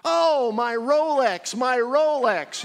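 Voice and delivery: very high, loud, slow hammed-up wail; wailing driver. Driver mourning his watch, hammed-up wail. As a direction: moan the punchline.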